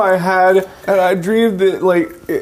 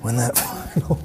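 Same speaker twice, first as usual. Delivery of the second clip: whisper; 6 semitones lower, 9 dB quieter than usual. Pretend. Dramatic whispered monologue.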